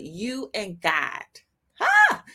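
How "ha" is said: high, loud burst of "Ha!"; sharp exclaimed "Ha!". Real, not acted. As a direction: let out a sudden high "Ha!" mid-sermon. Exclaims mid-preaching, overcome.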